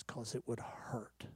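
slow, barely audible whisper; whispered realization. Retold or imitated. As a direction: breathe the retold answer as it dawns. Retold woman's dawning realization.

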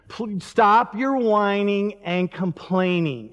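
slow, drawn-out plea; verging on whining. Shown as a demonstration. Pastor begging kids to stop complaining, verging on whining. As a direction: stretch the words into a near-whine. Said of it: "I almost went whining".

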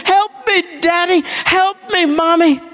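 put-on high childlike "Help me, help me." pleading; child crying for help. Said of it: "You would drop everything".

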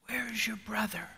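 whispered, soft and searching; God whispering to Cain; God's hushed question. Acted. God questions Cain after murder.

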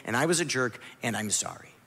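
low, soft admission of fault; plain apology. Retold or imitated. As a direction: drop down and own it quietly. The apology he should make.

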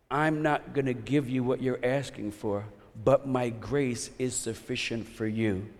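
hushed, tender promise of grace; gentle voice of God. Pretend. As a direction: speak God's reassurance quietly, like to a child. God's reply voiced softly.

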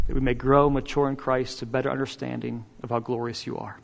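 low, subdued praying voice; prayer. Real, not acted. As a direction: pray quietly and reverently before the congregation. Opens the sermon in prayer.

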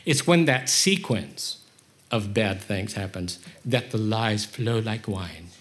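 ordinary talk

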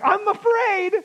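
timid high "I'm afraid"; mock fearful confession. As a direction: play Nehemiah admitting fear. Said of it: "And he's like".

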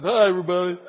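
put-on a light child's voice, chirpy despite tears; a toddler still saying hello through tears; tearful little boy greeting.

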